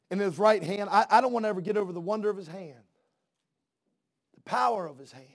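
normal talking voice

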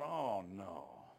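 low, soft dismay; hushed, sinking reaction; retold dismay. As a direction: let the bad news sink in, quietly. Retells his dismayed reaction.

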